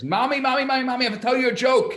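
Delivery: put-on very high, loud, fast child voice; excited little kid. Little child running up to tell mom a joke, high and loud. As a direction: call out like a bursting nine-year-old.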